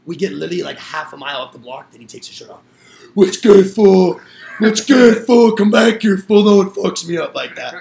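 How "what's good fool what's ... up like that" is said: loud, high-pitched yelling taunt; retold shouted challenge. Storyteller playing shirtless rival half a mile away, shouting. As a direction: yell it down the block as the beaten rival.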